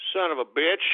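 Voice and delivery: normal talking voice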